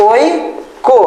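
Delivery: ওই, then কো, each on its own; syllable by syllable. Shown as a demonstration. Teacher building the word for students, syllable by syllable. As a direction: separate the sounds with a clear pause. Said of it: "এই ওই বানানোর জন্য".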